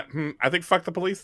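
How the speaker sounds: usual speaking voice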